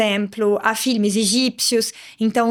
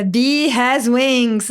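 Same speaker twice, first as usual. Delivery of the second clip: slow homework English; 3 dB louder, much slower than usual. Shown as a demonstration. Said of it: "a lição de casa era".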